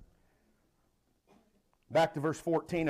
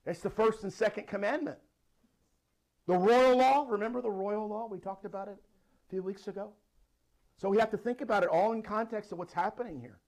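ordinary talk

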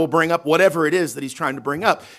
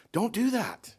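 ordinary delivery

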